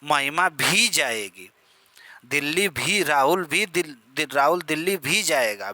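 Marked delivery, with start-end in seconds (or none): 4.2-5.7 भी hit firmly with a falling tune; stress on भी. Shown as a demonstration. Teacher demonstrating emphasis to class, भी stressed. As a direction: put the weight on भी. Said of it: "भी ये बल देने का काम कर रहा है".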